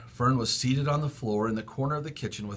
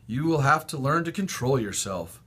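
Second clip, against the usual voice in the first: ordinary delivery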